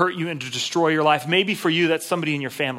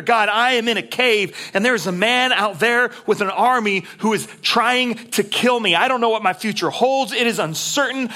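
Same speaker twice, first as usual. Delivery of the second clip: anguished prayer; 5 semitones higher than usual. Acted. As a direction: pour it out like a desperate prayer. Voicing David's overwhelmed prayer.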